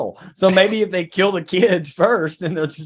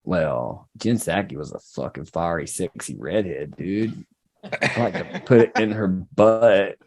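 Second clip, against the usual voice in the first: much lower, slower leering voice; low lusty voice; 9 semitones lower, slower than usual. Acted. Praising Jen Psaki, in a low leering voice. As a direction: drop low like a sleazy admirer.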